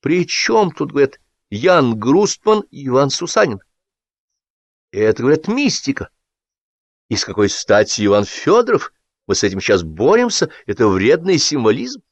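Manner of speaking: high, sing-song gushing enthusiast; a fawning hack beaming at his comrades; high-pitched over-eager voice; gushing Sapfirov. A narrator's reading. Sapfirov quoting critics.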